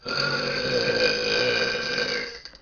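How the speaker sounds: long burp. Real, not acted. Burping contest on camera.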